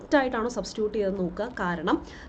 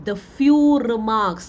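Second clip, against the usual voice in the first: emphatic answer; 10 dB louder, much slower than usual. Shown as a demonstration. Teacher reveals the correct answer.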